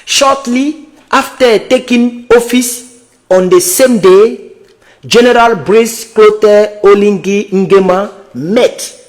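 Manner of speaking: slow, careful reading of English text; slow English read-aloud. Real, not acted. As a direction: read each English word out slowly. Reads English article aloud slowly.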